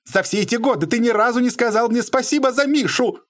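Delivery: high, sustained indignant cry; shrill accusation. A narrator's reading. Lady accusing Bobrov of ingratitude, shrill outburst. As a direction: keep it pitched very high, aggrieved. Shrill quarrel over money and thanks.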